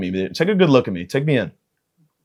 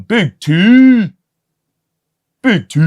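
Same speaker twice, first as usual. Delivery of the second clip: drawn-out hype call; 10 semitones higher, 6 dB louder, much slower than usual. Acted. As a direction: stretch it out very slowly, loud.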